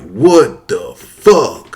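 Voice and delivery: very slow, drawn-out disbelief; stunned. Retold or imitated. Boy watching his cow gutted, stunned. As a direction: stretch each word out in shock.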